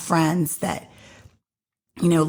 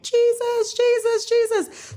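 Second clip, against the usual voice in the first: high-pitched chant of a clamoring crowd; crowd calling out; slower than usual. Acted. Crowd surrounding Jesus as he passes, calling out. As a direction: call it out high and drawn, like a mob.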